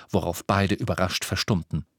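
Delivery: ordinary delivery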